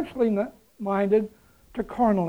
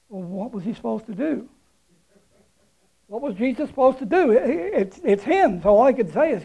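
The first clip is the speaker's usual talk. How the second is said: normal talking voice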